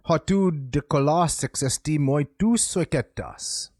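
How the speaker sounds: reading Greek in iambic trimeter, slowly; measured ancient Greek verse reading; ancient Greek recitation. Shown as a demonstration. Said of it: "This is what it would sound like".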